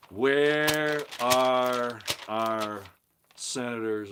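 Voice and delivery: long, loud, stretched cry of "where"; drawn-out call. Real, not acted. Host demanding where senators are, loud and drawn out. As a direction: call out to the missing leaders.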